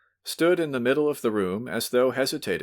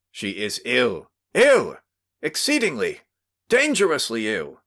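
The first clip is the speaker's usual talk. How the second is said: insistent shout; 4 semitones higher, 4 dB louder than usual. Acted. Host shouts about dying child.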